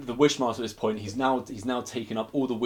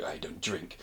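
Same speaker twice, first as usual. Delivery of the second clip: lower, much softer cold refusal; low flat Wishmaster; 5 semitones lower, 9 dB quieter, slower than usual. Retold or imitated. Wishmaster refusing Krichkov's drink, low and quiet. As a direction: turn the drink down coldly.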